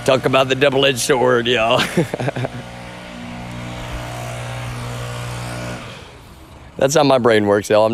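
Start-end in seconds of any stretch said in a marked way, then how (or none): 1.8-5.9 long laugh. Real, not acted. Long laugh after own joke.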